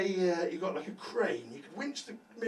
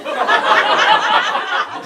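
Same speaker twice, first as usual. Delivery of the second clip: high loud burst of laughter; shrieking laugh; 18 dB louder than usual. Real, not acted. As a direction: crack up at the crowd. Laughs at crowd answer.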